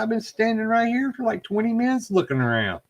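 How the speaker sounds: high-pitched retold objection; imitated protest. Teammate defending herself to investigator, high protesting voice.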